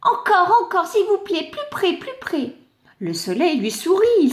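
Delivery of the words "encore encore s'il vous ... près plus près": high eager pleading; begging for more. Acted. The clod begging the sun to come closer. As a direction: pull the sun closer with each plea.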